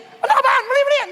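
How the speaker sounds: put-on loud, high scolding of a child; harsh scolding. Shown as a demonstration. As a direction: snap at the kid, loud and high. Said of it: "Hindi yung".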